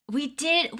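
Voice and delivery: ordinary talk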